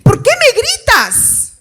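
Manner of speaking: high, drawn-out mock whine; hypersensitive child. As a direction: whine in exaggerated hurt at being yelled at. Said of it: "Le levanto un poquito la voz". (niño dolido, quejido agudo y exagerado)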